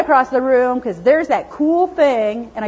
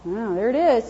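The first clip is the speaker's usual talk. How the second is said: wary little dog; 5 semitones lower, 8 dB quieter than usual. Retold or imitated. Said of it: "he might go".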